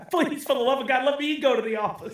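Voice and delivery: high-pitched mock desperate plea; playfully beg, pitched well up; hammed-up begging; mock pleading. Acting out parent begging to escape.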